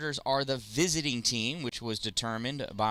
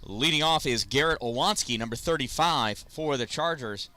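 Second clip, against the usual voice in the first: announcer intro; 3 semitones higher than usual. Acted. Announces pitcher and leadoff batter.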